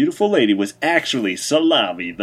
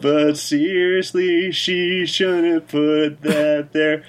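high, slow elf singer talking; 8 semitones higher, much slower than usual. Acted. Elf singer's spoken aside.

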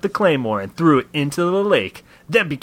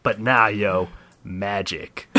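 normal talking voice

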